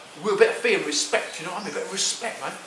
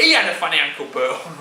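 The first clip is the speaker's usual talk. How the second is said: ordinary talk, nothing marked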